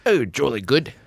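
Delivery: put-on light British accent, bright and pleased; a playful stab at a British accent; jokey British "jolly good"; mock British accent. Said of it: "that was actually better than I could".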